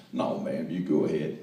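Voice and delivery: low-pitched Texas gentleman; courteous Texan man, deep and low; polite Texan man. Acted. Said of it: "two Texans trying to out-polite one another".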